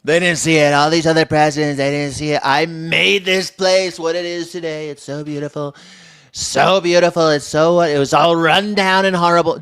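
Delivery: a hint of Trump's boastful cadence; Trump impression. Streamer imitating Trump after his clip, boastful. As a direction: brag like the president about the house.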